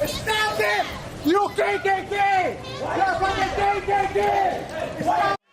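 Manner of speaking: high, slow accusing yell. Real, not acted. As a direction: shout the accusation. Man yelling at rally.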